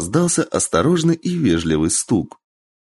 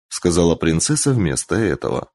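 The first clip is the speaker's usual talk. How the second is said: usual speaking voice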